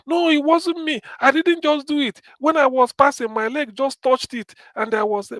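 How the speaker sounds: mock child excuse; small girl denying. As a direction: deny it like a little girl caught out.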